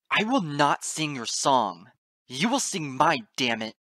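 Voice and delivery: slow, loud, furious defiance; defiant shout. A narrator's reading. Narrator refusing the goddess's song, shouting in defiance. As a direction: shout it over a roaring din. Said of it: "shouting over the ringing body of the Nightbringer".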